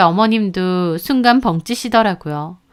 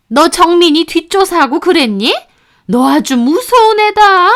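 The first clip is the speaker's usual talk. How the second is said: put-on higher, louder, accusing older woman; accusing mother-in-law; 7 semitones higher, 4 dB louder than usual. A narrator's reading. Mother-in-law accusing daughter-in-law of spying, hostile voice. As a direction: turn the blame on her with a glare.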